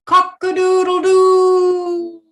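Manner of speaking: loud, high, drawn-out rooster crow; crowing proud rooster. Acted. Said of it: "start off by shouting loudly".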